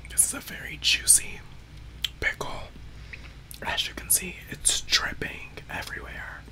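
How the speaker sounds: soft ASMR whisper. Real, not acted. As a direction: whisper close to the mic. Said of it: "I can't really whisper like that".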